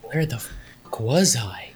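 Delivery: whispered, dazed "where am I" aside; baffled whisper. Acted. Missing cohost wondering where he has been, whispered. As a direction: whisper it as if lost and disoriented. Lost Mark comes back, puzzled.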